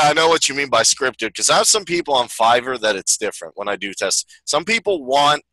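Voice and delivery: normal talking voice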